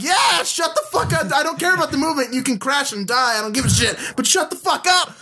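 high-pitched comic outburst at a screen character; exasperated rant. Retold or imitated. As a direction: rant as if you can't take another second. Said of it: "You just went on quite a rant".

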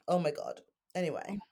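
ordinary delivery

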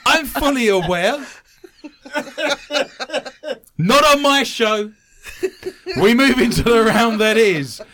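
loud laughing, high and breathy; laughing mock outrage. Real, not acted. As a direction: protest through laughter, playfully. Mock indignant host, laughing loudly.

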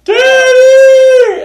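high, loud scream of Daddy; child's shout. Retold or imitated. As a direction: yell for Dad like a scared kid. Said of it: "Emma's like".

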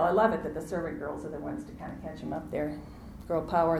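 normal talking voice

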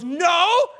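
very loud, high, indignant shout; son's outraged refusal. Retold or imitated. Son refuses grace for his brother, outraged shout. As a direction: yell it like a child objecting about a sibling.

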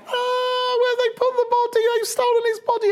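hammed-up crying in a very high voice; Mary Magdalene. Distraught woman at the empty tomb, hammed-up tearful wail. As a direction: squeal the words through mock sobs. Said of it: "she comes out and she's crying".

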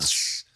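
short hissed "tss" of steak; imitated searing sizzle. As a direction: hiss like meat on hot rock. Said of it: "You could sear it".

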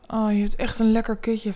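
ordinary delivery

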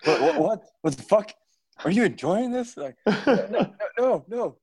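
high, loud mock outrage; shocked torturer. Night Lord shocked his victim enjoys it, high loud hammed-up outrage. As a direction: blurt it out, scandalized. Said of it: "And they're like".

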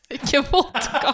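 drawn-out, laughing correction; slow the words down through laughter; laughing protest. Real, not acted. Storyteller corrects a co-host's wrong drink guess, laughing protest.

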